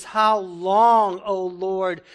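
loud, pleading cry to God; impassioned lament. Acted. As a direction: call out like a man desperate for an answer. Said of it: "David says".

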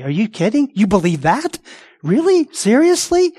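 high incredulous squeak; mock disbelief. Preacher mimicking an unbeliever's shocked disbelief.

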